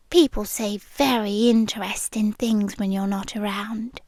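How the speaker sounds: hushed confiding whisper; whispered confession to the listener. Acted. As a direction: lean in and breathe it secretly. Sharing overheard secrets.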